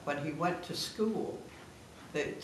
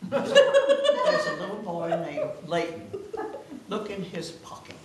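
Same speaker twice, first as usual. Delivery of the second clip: laughing; 12 semitones higher, 10 dB louder, slower than usual. Retold or imitated. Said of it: "She said".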